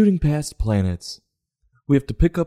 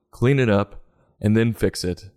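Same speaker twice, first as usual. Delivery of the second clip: normal talking voice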